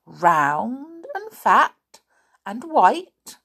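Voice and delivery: ordinary delivery, nothing marked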